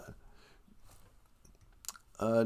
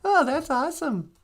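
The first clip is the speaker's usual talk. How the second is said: grandma voice; 14 semitones higher than usual. Acted. High grandmother voice.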